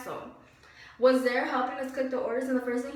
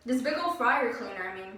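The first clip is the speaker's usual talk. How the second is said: usual speaking voice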